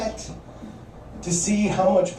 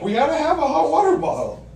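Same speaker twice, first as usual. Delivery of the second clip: imitating wife; 13 semitones higher, 5 dB louder than usual. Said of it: "She's like".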